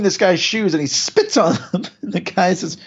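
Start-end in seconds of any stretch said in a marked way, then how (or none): none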